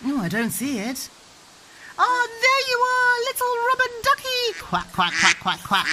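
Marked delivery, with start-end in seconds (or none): 2.0-4.5 high, loud delighted find; there you are, spoken way up high; delighted discovery. Real, not acted. Mother finding the toy duck for her child, delighted. 4.6-5.9 duck quacks. Shown as a demonstration. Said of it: "what sound does the duck make".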